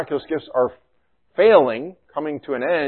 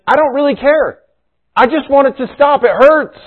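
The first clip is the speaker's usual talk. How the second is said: high, loud, hurting cry of someone who just wants relief; pained plea; 12 semitones higher, 7 dB louder than usual. Retold or imitated. Congregant tired of reasons, pleading with pastor, pained high plea. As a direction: plead it like the pain won't stop. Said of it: "sometimes people are, you know, like".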